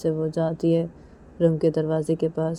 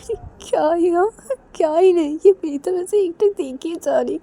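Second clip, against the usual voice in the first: higher voice holding back a laugh; higher suppressed-laugh thoughts; Reshma's inner voice; 8 semitones higher, 5 dB louder than usual. Acted. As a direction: think aloud, stifling giggles.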